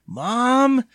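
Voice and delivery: high, loud cry for Mom; pleading. A narrator's reading. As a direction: wail out for help. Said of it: "he pleaded".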